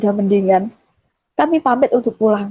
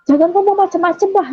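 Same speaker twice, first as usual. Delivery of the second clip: higher-pitched mild scolding; retold rebuke; 8 semitones higher than usual. Woman on phone rebukes younger sibling, high-pitched protest. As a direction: tell them off with raised pitch.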